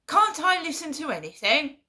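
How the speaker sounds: high, loud indignant outburst; retold shouting. Imitating mum shouting down the phone in indignation. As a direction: snap back as an offended mum. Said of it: "Started shouting down the phone".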